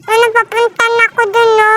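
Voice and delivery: usual speaking voice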